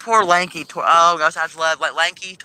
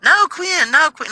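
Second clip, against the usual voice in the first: much higher, insistent no; emphatic correction; 12 semitones higher, 4 dB louder, much slower than usual. Real, not acted. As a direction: cut them off, correcting eagerly.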